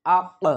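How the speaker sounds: আক with a bounced release on the final q; qalqalah push. Shown as a demonstration. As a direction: push off the last ক of আক. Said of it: "এরকমভাবে আমরা একটু ধাক্কা".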